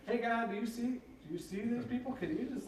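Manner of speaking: high, pleading mock exasperation; half-joking indignant appeal; mock complaint. Acting out complaint to God.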